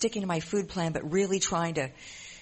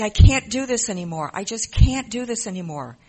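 loud, higher retold cry of despair; upset outburst; 5 semitones higher, 10 dB louder than usual. Reliving her breaking point at group, loud and upset. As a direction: blurt it out, at the end of your rope. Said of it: "I was really upset".

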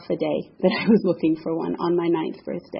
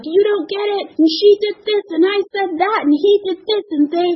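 much higher, louder frantic rant; ranting justification; 11 semitones higher, 5 dB louder than usual. Retold or imitated. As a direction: justify yourself to your husband. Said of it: "I'm like".

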